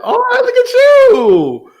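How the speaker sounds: high, loud, drawn-out cheer; real impressed whoop. Real, not acted. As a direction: whoop it up for your guest, stretching the words. Host cheering guest's first episode.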